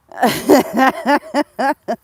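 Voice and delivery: loud, high rolling giggles; giggling fit. Real, not acted.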